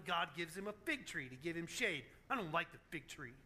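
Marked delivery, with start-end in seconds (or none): 2.3-3.3 low, soft sulky grumble; mock pout, dropping low and quiet. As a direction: mutter it like a sulking child. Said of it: "as he's sitting there pouting".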